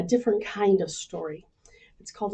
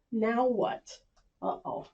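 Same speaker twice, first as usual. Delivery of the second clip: ordinary delivery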